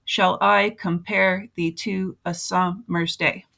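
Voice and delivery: slow, syllable-by-syllable beat, weak then strong: "Shall I compare thee to a summer's day?"; measured da-DUM meter on "Shall I compare thee to a summer's day?", stressing every second syllable; iambic stress. Shown as a demonstration. Teacher showing how to break down a poem, slow alternating stress on "Shall I compare thee to a summer's day?". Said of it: "There's a beat, there's a meter to it".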